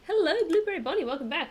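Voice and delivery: loud, high happy hello; excited greeting. Real, not acted. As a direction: greet the chat with a burst of joy. Greeting a viewer.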